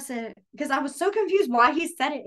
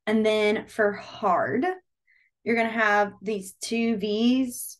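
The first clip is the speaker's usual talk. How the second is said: slow teaching; much slower than usual. Shown as a demonstration. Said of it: "slow teaching demo".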